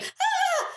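very high mock dog shriek; imitated poodle scream. Host imitating the dog in the back seat, poodle screaming. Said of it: "like, poodle screaming".

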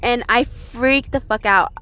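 plain reading voice

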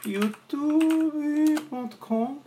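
words burped out slowly; talking while burping. Real, not acted. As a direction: burp the words out. Que arroto gostoso.

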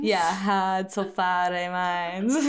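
put-on quick Boston accent, r dropped in hard and far; Boston accent with "hard" as hahd. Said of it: "like, a Boston accent".

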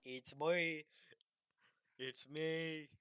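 lightly put-on presidential voice; Kennedy. Trying out a president's voice, imitation. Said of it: "I'm trying to do the Kennedy voice".

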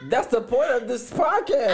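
laughing. Real, not acted. Playful scolding of cohost.

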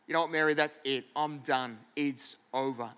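low, soft acted resignation; hushed, defeated husband; quiet finality. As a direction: end it in a subdued voice. Acted Joseph breaks it off.

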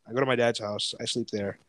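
usual speaking voice

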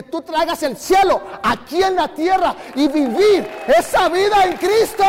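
rally the crowd; preaching shout. Real, not acted.

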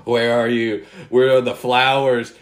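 mock-frustrated searching for flowers; exasperated searching. Gardener fixated on a weed, calling out in mock frustration.

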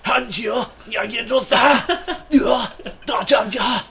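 high, loud fake Japanese; fake Japanese yelling. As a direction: yell fake Japanese words. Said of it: "good at fake Japanese".

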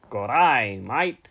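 deliberate fake Australian greeting; Mister Aussie. Shown as a demonstration. Host proving he sounds different from Mister Aussie, mock Australian accent. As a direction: put on a broad Aussie accent. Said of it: "this is me doing an impression of Mister Aussie".

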